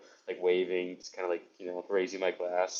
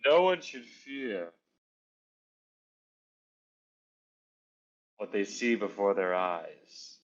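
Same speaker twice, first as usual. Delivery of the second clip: a mysterious sage pronouncement without turning; grand wizard pronouncement; slower than usual. Acted. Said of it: "without looking over towards them".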